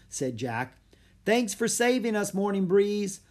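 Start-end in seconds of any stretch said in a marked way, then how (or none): 1.2-3.2 called. Acted. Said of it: "called Annie".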